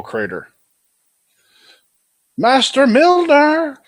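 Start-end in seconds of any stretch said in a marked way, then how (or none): 2.4-3.8 high, slow frantic shout; panicked cry. Acted. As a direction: call out in alarm. Character cries out master's name.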